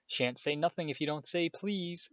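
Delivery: high, teasing sing-song taunt; lilting, needling mockery; annoying sing-song. A narrator's reading. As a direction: chant it like a bratty child. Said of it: "in his annoying sing-songy voice".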